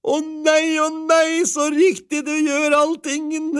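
wife overjoyed at the goat; delighted cry. A narrator's reading. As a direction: squeal with delight. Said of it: "ropte kona".

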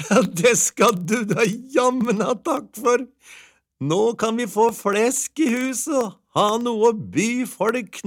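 put-on very high, excited old-tale housewife with little laughs; delighted wife. A narrator's reading. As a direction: gush in a high voice, chuckling between thoughts.

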